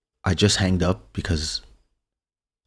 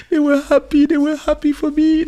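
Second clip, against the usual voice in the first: high, loud tearful voice breaking; imitating his own sobbing relief; retold tearful outburst; crying; 7 dB louder than usual. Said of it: "I start crying".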